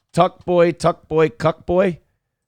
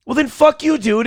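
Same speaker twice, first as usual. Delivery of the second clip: angry outburst; 9 semitones higher than usual. Retold or imitated. Ranting at a congressman, angry outburst. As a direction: snap at him in disgust. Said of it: "I'm just like".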